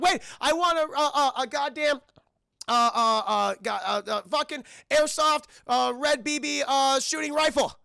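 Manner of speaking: high, loud, stumbling request; mock breathless yelling with stammers; frantic stammered wish. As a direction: blurt the wish out in a panic. Ralphie stammers his wish.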